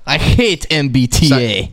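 put-on slow, heavy Russian-accented declaration; mock Russian accent. Friend parodying the crying train woman, slow mock Russian accent. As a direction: declare it slowly in movie-villain Russian. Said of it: "she was Dolph Lundgren from".

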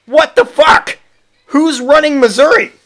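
high, incredulous what-the-hell; shocked outburst. Real, not acted. Reading a weird law, high shocked outburst. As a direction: blurt it out in disbelief.

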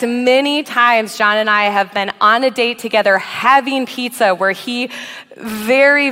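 ordinary talk, nothing marked

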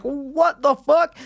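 high, slow, stunned; stunned disbelief. Retold or imitated. Jail cell scene reaction, high slow shock. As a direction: let the words hang in shock. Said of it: "and you're like".

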